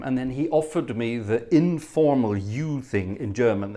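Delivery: normal talking voice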